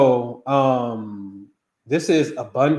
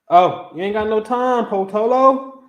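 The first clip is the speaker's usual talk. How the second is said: high, louder teasing; playful teasing; 9 semitones higher, 7 dB louder than usual. Real, not acted. Host teasing a viewer who gave no birth time, high and loud. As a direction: tease her for leaving out the time.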